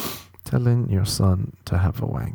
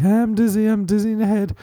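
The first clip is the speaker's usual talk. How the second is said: high, whiny-comic son voice; Terrence; 9 semitones higher than usual. Pretend. Aunt's son saying he's dizzy, high comic voice. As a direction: put on a silly high kid complaining. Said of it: "that's Anne Terry's son".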